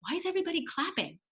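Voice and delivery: curious question. Retold or imitated. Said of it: "And I said".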